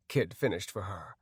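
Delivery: usual speaking voice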